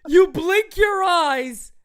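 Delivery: high, laughing-crying exasperated yell. Real, not acted. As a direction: yell in real disbelief at the manager. Yelling at the manager.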